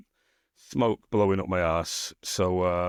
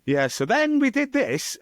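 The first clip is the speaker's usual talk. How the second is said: much higher, louder and slow, a hammed-up yell; mock shout; 4 dB louder, slower than usual. Shown as a demonstration. Podcast host mocking his guest's shouted question, hammed-up yell. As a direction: blast it out like someone who won't talk normally. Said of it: "It's gonna be like".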